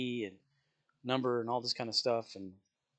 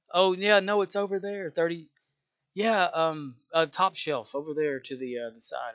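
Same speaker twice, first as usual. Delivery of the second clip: flustered cashier; 5 semitones higher, 6 dB louder than usual. Shown as a demonstration. Cashier juggling two customers.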